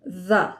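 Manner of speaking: the said with a buzzing th, as in these; the voiced through the teeth. Shown as a demonstration. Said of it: "as in these".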